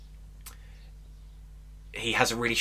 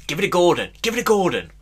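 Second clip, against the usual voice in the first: raised, higher-pitched demand for the ball; mock shout for a pass; 8 semitones higher, 5 dB louder, much faster than usual. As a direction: yell it like an insistent touchline shout. Acting out shouts for Gordon.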